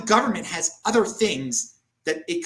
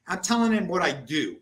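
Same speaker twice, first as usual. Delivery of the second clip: ordinary talk, nothing marked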